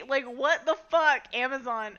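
loud, slowed, fed-up swearing; exasperated outburst. Real, not acted. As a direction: blurt it out in real frustration. Annoyed at Amazon popup.

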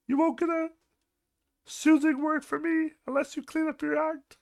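high, strained lecturing voice; Jordan Peterson impression. Lecturing the messy coworker as Jordan Peterson, high strained voice. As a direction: preach tidiness like a stern professor. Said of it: "turn to Jordan Peterson on your ass".

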